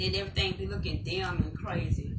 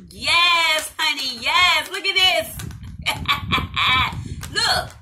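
much louder, higher gushing over earrings; excited hype; 6 semitones higher, 8 dB louder, slower than usual. Real, not acted. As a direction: shout your delight at the sparkle. Showing off the earrings.

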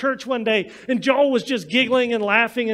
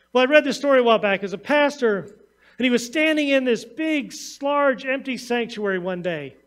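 ordinary delivery

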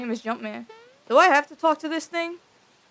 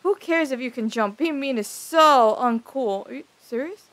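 ordinary talk, nothing marked

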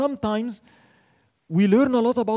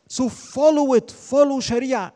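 slow, higher and louder, pressing each word; emphatic command; 7 semitones higher, 5 dB louder, slower than usual. Real, not acted. Preacher urging listeners to follow Sharia, slow forceful command. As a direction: urge it hard, like a firm order.

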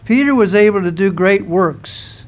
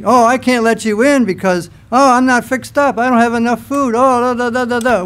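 higher-pitched mock excuse-making; 5 semitones higher than usual. Householder putting off Jesus at the door, mock excuses. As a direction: rattle off feeble excuses, higher, tailing into filler. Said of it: "Or would I say".

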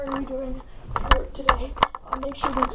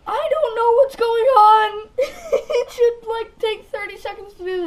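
exasperated wail; 9 semitones higher, 7 dB louder than usual. Real, not acted. Frustrated computer won't load.

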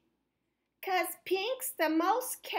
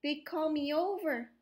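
usual speaking voice

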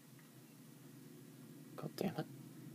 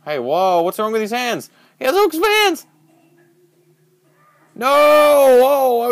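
higher, louder, shocked outcry; startled shout; 12 semitones higher, 11 dB louder than usual. Real, not acted. Player spotting a hook-handed killer, startled shout. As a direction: yelp in alarm at the hook-handed man.

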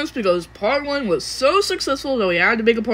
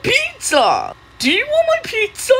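high, excited cartoon pizza seller; excitable cartoon voice, pitched way up; pushy pizza pitch; 12 semitones higher than usual. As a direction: pipe up high and eager to offer it. Pizza character keeps pitching pizza.

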